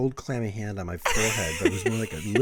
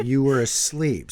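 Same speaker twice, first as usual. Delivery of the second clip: louder, slow curt brush-off; dismissive wife; 5 semitones higher, 5 dB louder, slower than usual. Retold or imitated. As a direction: brush him off flatly.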